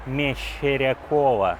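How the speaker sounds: slow and clear. Shown as a demonstration. Spelling out hard surname.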